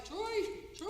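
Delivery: high call for her husband. Retold or imitated. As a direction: call his name across the hills. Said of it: "I called out for him twice".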